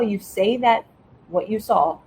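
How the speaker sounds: ordinary delivery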